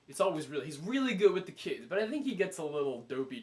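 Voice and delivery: ordinary talk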